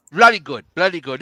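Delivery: loud, hammed-up British approval; mock British accent. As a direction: play at being British, half joking. Jokey English accent after British pronunciation.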